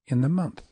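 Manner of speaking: "In the" quick, landing on "month"; "In the month" as short, short, long; two shorts. Shown as a demonstration. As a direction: run In the together into month. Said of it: "substituted those two longs with two shorts".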